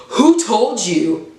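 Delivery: drawn-out, guarded "Who told you?"; slow suspicious question. Retold or imitated. Duke confronted by his girlfriend, slow and guarded. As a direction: stretch it slow, eyes narrowed.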